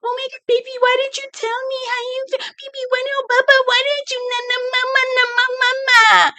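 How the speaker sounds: hammed-up mock baby voice; baby talk. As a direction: coo like talking to a toddler. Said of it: "Then she gets into this baby talk".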